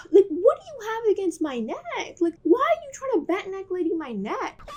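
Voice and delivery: high, strained, sighing complaint; exasperated whine. Real, not acted. Complaining about her neck in the sink, high and pained. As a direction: plead with the stylist, voice up and cracking.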